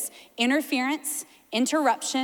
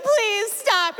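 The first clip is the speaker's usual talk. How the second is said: put-on high pleading child; whining kid; 9 semitones higher, 3 dB louder than usual. Sleepy kid begging a singing parent to stop, high. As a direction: beg like a groggy kid.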